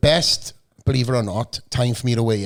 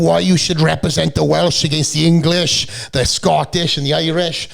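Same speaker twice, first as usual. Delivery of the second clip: high, mock-rousing battle speech; rally the troops in playful imitation; Braveheart speech; 5 semitones higher, 4 dB louder than usual. Bodybuilder mimicking a judge's patriotic pep talk, mock-rousing. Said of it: "he went full Braveheart speech".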